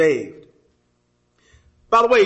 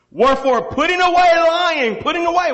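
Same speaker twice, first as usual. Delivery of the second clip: pitched up high and louder, pressing the point; rising preacher's emphasis; 9 semitones higher, 4 dB louder than usual. Real, not acted. Preacher to the congregation, rising and louder. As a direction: lift it up and drive it home.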